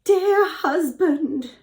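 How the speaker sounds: high, slow, loud put-on wail; mock weeping. A narrator's reading. Lying wife wails to her husband, mock weeping. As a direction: drag it out in pretend tears. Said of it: "weeping and wiggling her lips".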